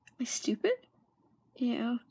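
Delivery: whispered, barely voiced question; frightened whisper. Girl stuck on a question doubts herself, in a scared whisper. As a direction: ask it under your breath in panic.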